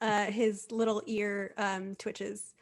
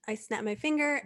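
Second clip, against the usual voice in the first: ordinary delivery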